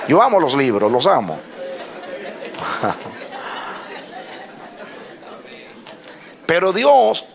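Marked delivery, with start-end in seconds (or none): 1.4-4.2 long, quiet chuckle; soft laugh. Real, not acted. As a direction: laugh softly to yourself, trailing on. Laughs after confessing love of books. (risa suave y prolongada)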